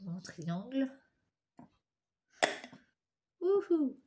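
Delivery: usual speaking voice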